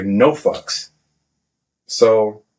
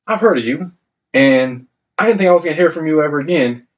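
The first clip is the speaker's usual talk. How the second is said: higher-pitched retold scolding from a superior; chewing out; 7 semitones higher than usual. Senior chief to sailor sent to his office, retold chewing out. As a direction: imitate a chief laying into a recruit. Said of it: "he started getting in my, in, on my shit".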